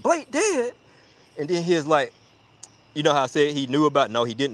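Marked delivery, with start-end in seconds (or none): 0.0-0.7 high-pitched incredulous question; mock astonishment, high; shocked disbelief. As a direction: imitate a stunned friend, voice pitched up. Friend hears of a death.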